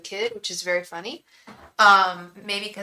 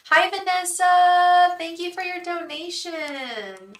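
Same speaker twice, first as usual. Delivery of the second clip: higher, louder hello, then slow thanks; bright greeting; 9 semitones higher, 7 dB louder, slower than usual. Real, not acted. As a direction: greet the donor warmly. Greeting a donor on stream.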